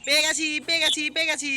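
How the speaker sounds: high, loud excited cry; excited exclaiming. Real, not acted. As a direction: shout it out with delight. Finds item mid-game, exclaims.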